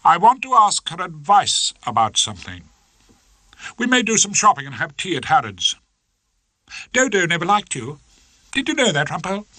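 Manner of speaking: a hint of a haughty wife; wife announcing plans, cool; Hilda voice.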